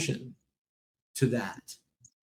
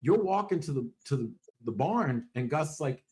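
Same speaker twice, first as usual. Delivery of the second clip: ordinary talk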